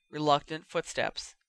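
usual speaking voice

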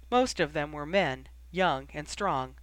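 usual speaking voice

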